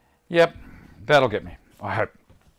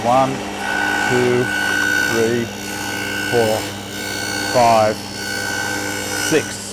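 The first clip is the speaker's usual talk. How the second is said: shouting over table saw; slower than usual. Real, not acted. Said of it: "so I stop talking too loud".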